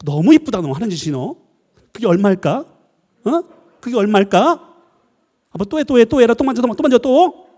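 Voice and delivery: put-on loud, biting sarcasm at a child; menacing mock praise. Parent glares at child over broken bottle, sarcastic scolding. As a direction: praise and egg on while meaning the opposite. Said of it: "말은 이쁘다고 하는데 눈은 애를 막 죽이려고".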